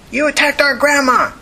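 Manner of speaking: high, accusing yell; angry kid's yell. Acted. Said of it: "the kid yelled angrily".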